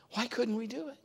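high, soft, whiny question; puzzled disciples. Retold or imitated. Disciples asking Jesus why they failed, high and whiny. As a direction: sound like baffled followers.

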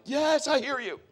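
put-on high exasperated reply to a nagging child; mock-exasperated parent answering; worn-out yes. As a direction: give in wearily, a parent at the end of their rope. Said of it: "you finally just want to be like".

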